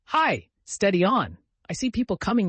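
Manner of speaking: high, loud warning call; urgent warning shout. A narrator's reading. Vicar warning the boys people are coming, high and loud. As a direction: shout a warning through the trees. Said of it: "his voice changed as if every pine tree was a rural dean".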